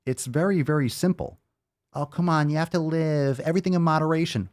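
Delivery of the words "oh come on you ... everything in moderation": high, fast mock-pleading whine; mimicking a critic, high and quick. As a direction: parody the excuse-maker. Mocking imagined critics.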